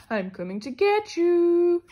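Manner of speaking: spooky threat. Acted. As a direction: stretch it out to scare. Skeletons frightening each other.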